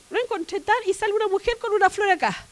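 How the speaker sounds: ordinary talk, nothing marked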